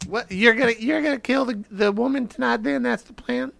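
high, questioning put-on voice; high-pitched Higgins. Player as Higgins pressing his rival about tonight, high-pitched. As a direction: keep it squeaky and probing. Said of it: "High pitched".

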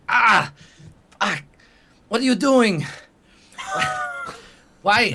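high yelps of pain after being shot; pained cries. Acted. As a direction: cry out, wounded and shocked.

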